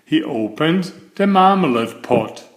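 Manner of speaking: ordinary delivery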